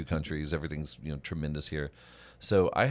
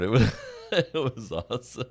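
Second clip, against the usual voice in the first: laughing through the words, higher; 7 semitones higher than usual. Real, not acted. Friend recalls the wine story, laughing. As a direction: talk through real laughter.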